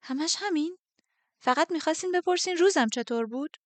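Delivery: astonished relieved blurt; blurted disbelief. Acted. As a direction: it bursts out before she can stop it. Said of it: "از دهنم پرید که".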